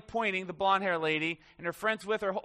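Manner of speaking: ordinary talk, nothing marked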